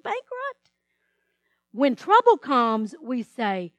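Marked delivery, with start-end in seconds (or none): 0.0-0.7 high, self-pitying wail of "why me"; whining complaint. Retold or imitated. Believer whining to God when trouble comes. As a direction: moan at God like a victim.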